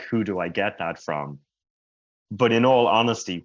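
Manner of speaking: ordinary talk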